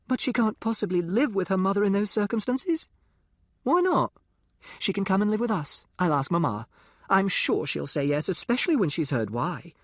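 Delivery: put-on high, eager well-bred girl's voice; a sheltered rich girl thrilled by gossip, pitched high; posh young girl.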